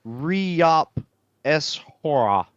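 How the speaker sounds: horsepower said backwards, "Re-op S hora"; backwards speech. Shown as a demonstration. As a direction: sound out "Re-op S hora" slowly. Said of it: "you might say something like".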